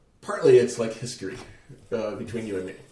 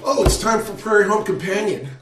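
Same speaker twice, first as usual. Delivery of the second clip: higher, louder, mock-excited exclamation; imitated fan enthusiasm; 7 semitones higher, 5 dB louder than usual. Friend imitating poet's delight at the radio, mock excited. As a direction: play the eager radio fan. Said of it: "and you said something effective".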